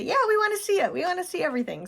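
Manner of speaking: high eager begging voice; excited high-pitched request; eager viewers. Retold or imitated. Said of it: "reach out and say".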